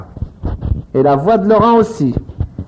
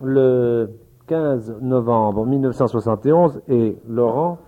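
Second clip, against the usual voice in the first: recording announcement; 8 semitones lower, 6 dB quieter, slower than usual. Acted. Dated announcement for the tape.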